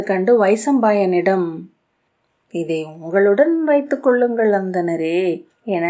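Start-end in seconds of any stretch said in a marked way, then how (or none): none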